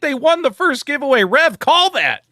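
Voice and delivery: high, loud celebratory yell; excited shouting. Real, not acted. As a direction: cheer it out loud. Celebrating winner.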